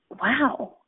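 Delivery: breathy whispered wow of realisation. Retold or imitated. To herself, watching the woman, a whispered wow. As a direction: breathe the word out in awe.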